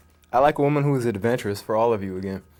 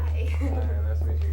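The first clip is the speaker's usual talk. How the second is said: whispering; slower than usual. Acted. Shy greeting to chosen date.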